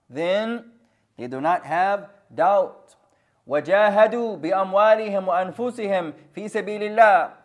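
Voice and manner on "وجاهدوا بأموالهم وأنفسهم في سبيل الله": recitation. Shown as a demonstration. Recites verse part again.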